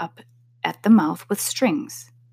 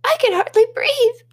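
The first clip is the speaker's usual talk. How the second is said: squeaky Dormouse; 5 dB louder than usual. A narrator's reading. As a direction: squeak it out like a tiny mouse. Dormouse complains.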